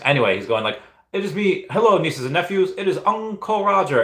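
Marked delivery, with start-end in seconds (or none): 1.1-4.0 Uncle Roger voice. Retold or imitated.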